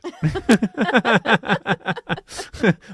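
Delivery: high helpless giggling fit; real giggles bursting out. Real, not acted. Host losing it on air, giggling. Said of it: "I'm all giggly and wiggly".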